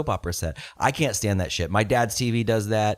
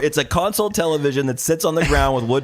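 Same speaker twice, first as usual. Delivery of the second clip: laughing mock inspection; 5 semitones higher, 4 dB louder than usual. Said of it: "You look at it and you go".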